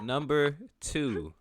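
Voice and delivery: slow, drawn-out announcing; announcer voice. Acted. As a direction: announce it slowly for drama.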